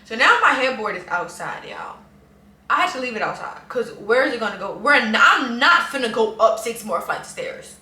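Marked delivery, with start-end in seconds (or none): none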